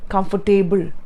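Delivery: COMFORTABLE as "comfor-TAY-bul"; wrong "comfortable" with full "table" ending; mispronunciation. Shown as a demonstration. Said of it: "as long as you don't say".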